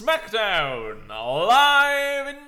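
high, loud, slow showman delivery; catchphrase impression. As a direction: stretch it out like a TV presenter. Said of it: "Wow, that was really good".

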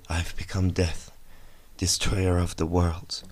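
quiet, slow, grave remorse; remorseful. Retold or imitated. Oppenheimer after the bomb, quiet slow remorseful. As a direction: say it softly as if full of regret. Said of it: "like he was remorseful".

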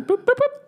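high boops like dialing buttons; keypad beeps. Shown as a demonstration. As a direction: imitate punching in a fax number. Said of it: "You punch the number in".

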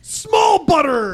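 high, slow hammed-up yell; high mock shout. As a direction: squeal it out like a silly ad. Shouting Wendy's menu items game.

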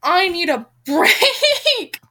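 slow, loud laughing plea; laughing exasperation. Real, not acted. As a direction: laugh it out, worn down. Reader gives up, exasperated.

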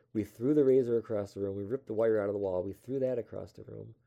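excited. Real, not acted. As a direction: relive the panic loudly. Panicked moment retold.

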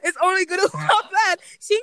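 words half-swallowed by a laugh; laughing through words. Real, not acted. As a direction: giggle your way through it. Laughing at cringe movie.

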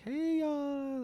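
high, drawn-out mock greeting; playful high-pitched holler without volume; alien greeting. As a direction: sing out a pretend alien hello, high. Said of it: "been like".

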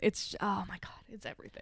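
hushed, unvoiced awe; awed whisper. Real, not acted. Fan gushing over the acting, awed whisper. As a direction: whisper it as if overwhelmed.

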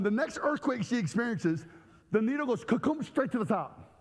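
ordinary delivery

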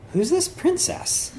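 high, slow, amused puzzlement; a young prince squinting at a strange portrait; puzzled prince. Retold or imitated. Prince finding the old painting, high, slow wondering. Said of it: "He's like".

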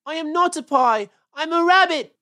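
high, loud rabbit wail; loud, upset cry of protest. Acted. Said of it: "wailed Peter suddenly".

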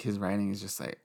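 usual speaking voice

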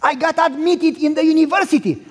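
put-on excited young son calling his father. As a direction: blurt it out like a thrilled kid on the phone. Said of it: "you called me excited".

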